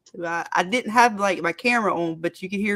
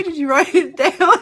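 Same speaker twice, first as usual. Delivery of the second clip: high, slow, loud laughing mimic; mock question; 9 semitones higher, 7 dB louder than usual. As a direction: ham up the question while laughing. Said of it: "she didn't say".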